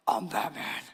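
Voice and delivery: low, growled Batman impression. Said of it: "repeat back to us in the voice".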